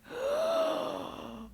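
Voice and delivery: a sudden high startled gasp; sharp frightened gasp. Retold or imitated. Seeing a stranger at her car window, startled gasp. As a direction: gasp in shock at the face at the window.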